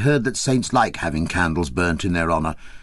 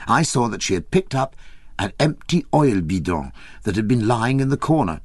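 usual speaking voice

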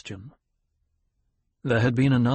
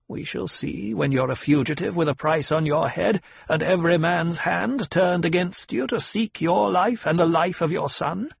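put-on higher, stern old woman; a demanding elder questioning her pupil; old Reverend Mother; 7 semitones higher than usual. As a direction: severe old matriarch.

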